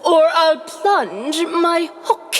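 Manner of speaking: slow, menacing pirate threat; Hook impression. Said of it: "Wendy, watch this".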